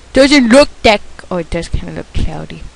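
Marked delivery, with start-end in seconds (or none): none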